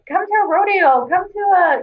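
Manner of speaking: high-pitched coaxing imitation; imitating eager invitation. Locals inviting the newcomer along, high mock-eager.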